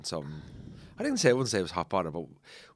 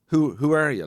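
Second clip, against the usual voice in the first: insistent demand; 5 semitones higher, 9 dB louder, slower than usual. Retold or imitated. Worker insisting, retold.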